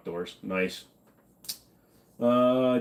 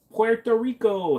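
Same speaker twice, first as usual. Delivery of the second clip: drawn-out excited greeting; 11 semitones higher, slower than usual. Acted. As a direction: stretch Puerto Rico high and slow like a hype announcer. Greeting viewer from Puerto Rico.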